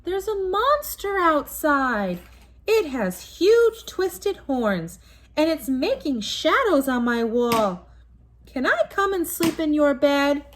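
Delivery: high, slow, frightened cub voice; scared young bear. Acted. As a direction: whimper out the monster fears.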